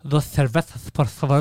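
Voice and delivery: mock Castilian lisp on "cervezas"; lisping Spanish king. Retelling the lisping Spanish king, mock Castilian lisp. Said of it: "He's like".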